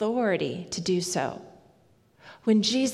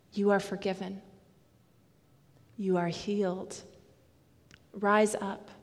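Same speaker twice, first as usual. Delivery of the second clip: quiet, unhurried words of comfort; Jesus speaking tenderly; 4 dB quieter than usual. Retold or imitated. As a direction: say each short blessing gently, letting it rest. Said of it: "hear him say to us".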